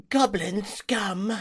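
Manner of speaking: high, slow mock-tearful cry; hammed-up wounded wail; crying insult. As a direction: spit the insult through put-on tears. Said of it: "he immediately starts crying".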